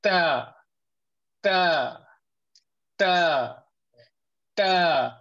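단단하게 끊어 말하는 ta; firm, solid ta, tongue on the gum roots. Shown as a demonstration. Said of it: "keep your sound solid".

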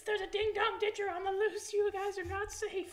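high, slow mock warning voice; mock alarmed mom. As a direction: drawl a hammed-up warning. Mocking the mom's warning.

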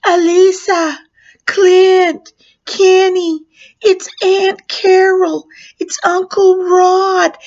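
high, loud, drawn-out calling through a door; mom yelling. Retold or imitated. Mom to screaming children through the door crack, urgent yell. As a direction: yell each name slowly to reach frightened kids. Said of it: "trying to yell through the crack".

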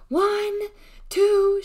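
high, very loud drawn-out howl. A narrator's reading. Deli counts to hold off the fight, howling. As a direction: howl each number at full voice. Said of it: "she howled".

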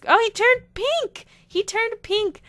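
high, loud burst of delight; delighted shriek. Real, not acted. Gamer seeing her sim turn pink, shrieking with delight. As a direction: squeal it out, truly thrilled.

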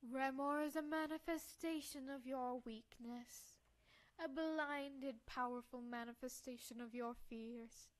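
loud, weighty villain delivery; imperious old doctor. Acted. Old doctor explains the monster to the girl, imperious. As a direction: declaim it slowly and forcefully.

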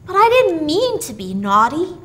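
a hint of a child excusing himself; child's voice. A narrator's reading. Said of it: "said little Billy meekly".

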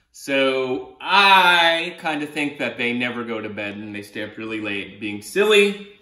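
plain reading voice

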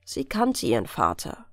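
ordinary delivery, nothing marked

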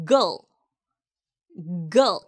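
gal as a weak "gul"; short unstressed model of gal; relaxed mouth. Shown as a demonstration. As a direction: let gal go slack, not a full "gal". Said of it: "힘을 빼고".

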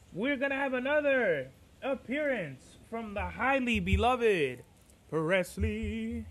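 soft, drawn-out showman's introduction; mock announcer. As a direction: announce a beloved guest, playfully grand but hushed. Mock introduction of the dog to listeners.

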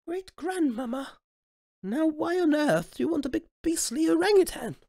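put-on high childish pleading question; high little-child voice asking; royal baby voice. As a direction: speak up like a bold small child. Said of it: "the royal baby spoke up".

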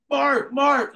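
high frantic shouting of a name; desperate shout from the creek bank; calling out. Retold or imitated. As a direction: yell the name across the ice.

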